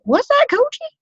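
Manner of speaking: very high, rising shocked ask; mock cashier double take; startled question. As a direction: imitate the cashier's surprise. Cashier asks about the product.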